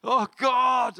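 high, loud mock lament; mocking a showy mourner crying out to God; mock wailing. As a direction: ham up a sackcloth wail. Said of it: "rumzuheulen und in Sacktuch rumläufst".

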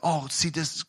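ordinary delivery

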